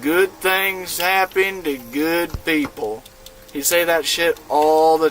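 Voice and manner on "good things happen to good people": put-on slow, drawn-out Southern accent; slow Southern drawl quoting an old friend's motto. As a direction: drawl it like a kind Georgia guy. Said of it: "in his fucking sick southern, southern accent".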